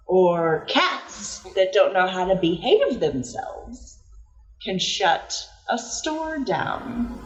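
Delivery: slow, deliberate warning with weight on "can"; slow stern lecture. Acted. Inspector cat warns the new cat, slow and stern. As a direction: lay down the law slowly.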